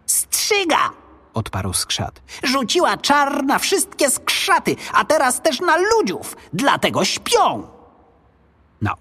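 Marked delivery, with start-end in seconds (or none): 0.0-0.9 very high-pitched goblin cry; squeaky little creature sounding the alarm. A narrator's reading. Goblin answers boy in alarm. 2.4-7.7 high-pitched little creature explaining urgently; goblin voice. A narrator's reading. Goblin explains the monster's spell.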